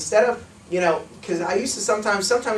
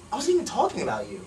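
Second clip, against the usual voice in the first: mock-baffled, softer protest; feigned surprise; 3 semitones higher, 5 dB quieter than usual. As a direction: act puzzled that they took it personally. Feigns surprise at being rejected.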